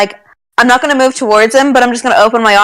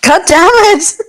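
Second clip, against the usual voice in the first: mock frustration; 8 semitones higher than usual. Frustrated at the scared creature.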